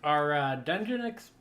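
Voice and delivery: talking around a paintbrush held in the teeth; words muffled by a brush between the teeth; paintbrush in mouth. Real, not acted. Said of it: "sorry, I got the paintbrush in my mouth".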